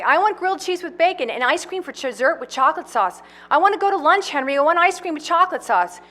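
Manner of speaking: put-on high, eager man-child voice; childlike Farley. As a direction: whine it like an excited kid.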